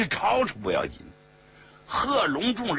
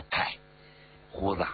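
low, dismissive address; cold scoff; 8 semitones lower, 6 dB quieter than usual. A narrator's reading. Xia Xi sneers at He Long, cold scoff. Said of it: "冷笑了一声".

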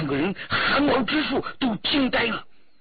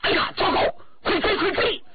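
high, urgent cry; alarmed exclamation; 13 semitones higher than usual. A narrator's reading. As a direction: cry out in sudden alarm, pitched right up. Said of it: "胡世同忽然惊呼". (忽然惊呼，又高又急)

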